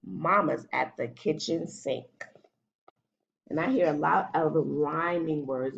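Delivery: ordinary delivery, nothing marked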